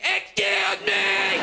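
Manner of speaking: slow, high squealed mimicry; mocking the lady. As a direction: drag out a jeering, high-pitched imitation. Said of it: "mock her and be like".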